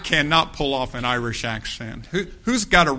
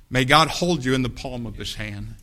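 ordinary talk

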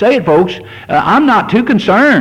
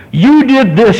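higher, accusing "You did this"; gruff accusing voice; 8 semitones higher, 3 dB louder than usual. Retold or imitated. Preacher voicing Satan's condemning voice, gruff and accusing. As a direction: point the finger like a harsh accuser. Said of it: "it's never in a gruff, terrible".

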